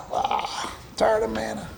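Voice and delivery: hushed, worn-out sigh of disgust; mock-weary near whisper; weary complaint. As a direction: whisper it, sick of the same food. Voicing Israelites sick of manna.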